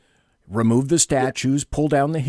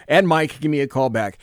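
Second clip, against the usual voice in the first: normal talking voice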